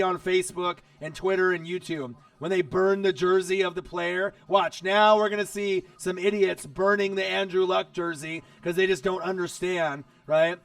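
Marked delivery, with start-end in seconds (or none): none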